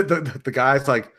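normal talking voice

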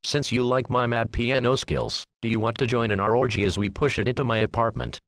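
plain reading voice

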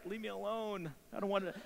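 small, high, soft protest; imitated reluctant child. Child protests to pushy father.

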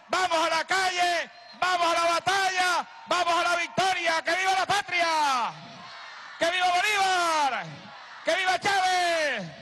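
high shouted rally chants; ranting dictator speech. Real, not acted. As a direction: whip the crowd up. Political rally closing chants.

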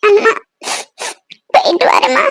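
weepy parting words; crying goodbye. Acted. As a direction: call to mother through tears. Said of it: "அழாதீங்கன்னு சொல்லிட்டு இருக்கேன்".

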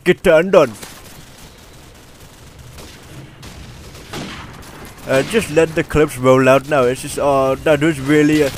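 put-on Indian-accented game commentary voice. Gamer narrating his gameplay clips, in an Indian accent. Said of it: "this is my Indian voice".

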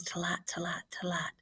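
soft, hushed hoofbeats; hoofbeat imitation. Teacher showing students the horse hooves in the story, hushed hoofbeats. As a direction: quietly sound out galloping hooves.

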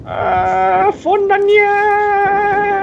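high, very slow drawn-out wail; singsong wail. Real, not acted. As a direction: stretch it out up high.

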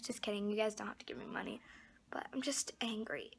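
angry whisper under the breath. Real, not acted. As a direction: whisper it, fed up and annoyed. Frustrated, drops to whisper.

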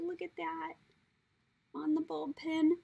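ordinary talk

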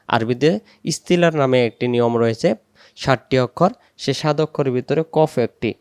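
normal talking voice

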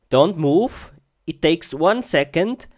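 normal talking voice

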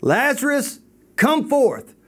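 high-pitched call at normal volume; loud voice. Retold or imitated. Jesus summoning dead Lazarus from the tomb, mock loud call. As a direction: imitate a commanding call, pitched well up. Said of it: "he said at a loud voice".

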